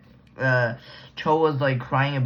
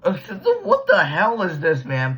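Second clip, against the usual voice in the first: laughing disbelief; 4 semitones higher, 4 dB louder than usual. Real, not acted.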